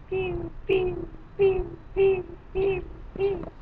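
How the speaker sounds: soft, high mouth gunshot pews; gunfire noises. Pretend. Gamer voicing his own sniper shots, soft high pews. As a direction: play pretend guns, light and quiet. Mimicking gunfire while shooting.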